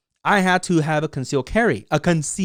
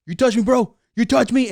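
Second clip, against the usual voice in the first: mock tough-guy challenge; 8 semitones higher, 4 dB louder than usual. Mimicking armed protesters.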